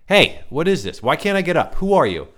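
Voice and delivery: high, loud alarmed questions; trapped and starting to panic; panicked demand. A narrator's reading. Ryan stuck on the couch, panicked loud questions.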